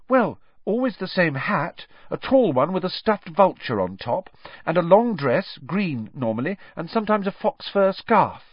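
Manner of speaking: put-on young pupil's earnest answer; startled schoolboy describing. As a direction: list the details for the teacher.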